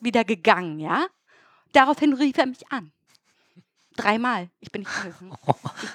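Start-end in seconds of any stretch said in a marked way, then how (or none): 4.6-5.4 dropped almost to a murmur; quiet insistence. Shown as a demonstration. To her friend, first take of "Ich bin nicht rangegangen", quiet. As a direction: say it low and quiet, almost to yourself. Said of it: "Nein, nein, nein".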